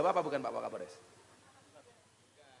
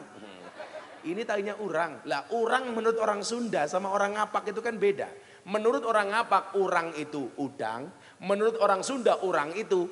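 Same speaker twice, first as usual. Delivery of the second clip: ordinary delivery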